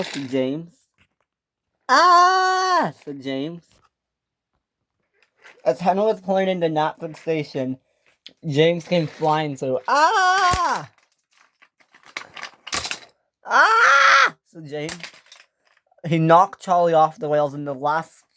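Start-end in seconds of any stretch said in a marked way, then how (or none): 1.9-2.9 high, loud scream; crashing engine's scream. Acted. James crashing. 9.9-14.4 very high, loud scream; crashing engine yells. Acted. James crashing.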